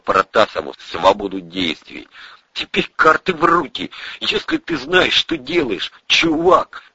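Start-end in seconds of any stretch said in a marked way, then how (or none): 2.5-6.8 high-pitched buddy voice; high character voice; slangy young sidekick. Acted. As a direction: hand it over like a doubtful pal.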